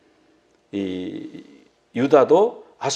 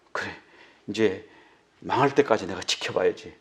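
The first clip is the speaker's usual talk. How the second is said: muttered curse; 6 semitones lower, 4 dB quieter than usual. Retold or imitated. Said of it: "저주하면서 요나가 이러는 거예요".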